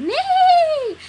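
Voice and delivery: very high, loud neigh; horse whinny. Retold or imitated. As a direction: squeal like a toy horse. Horse toy sound.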